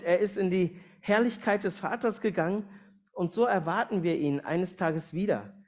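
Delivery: ordinary delivery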